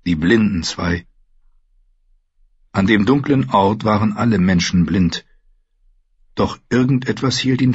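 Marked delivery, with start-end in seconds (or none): none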